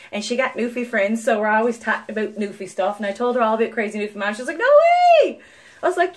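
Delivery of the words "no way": imitated high squeal of surprise; amazed disbelief. Retelling the hygienist's reaction to Newfie stuff, mock amazement. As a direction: exclaim it like a thrilled fan.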